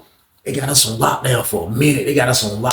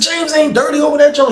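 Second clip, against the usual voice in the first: much higher, insistent defense; high insistence; 14 semitones higher than usual. Retold or imitated. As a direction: defend your friend, disbelieving. Defending friend to cellmate.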